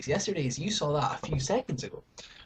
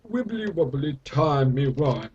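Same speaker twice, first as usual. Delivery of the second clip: a hint of the Doctor, slow; Doctor impression; 3 dB louder, slower than usual. Badly imitating Matt Smith's Doctor slowly. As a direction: lightly mimic the Time Lord, drawn out. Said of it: "That's not how he speaks".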